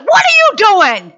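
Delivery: high, forceful scolding; tough encouragement. Acted. Preacher acts out tough encouragement, high forceful prodding. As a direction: demand that they get moving. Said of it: "Sometimes encouraging isn't a".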